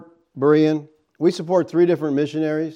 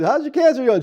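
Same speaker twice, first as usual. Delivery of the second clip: mock innocent question; 10 semitones higher, 4 dB louder than usual. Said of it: "and I said".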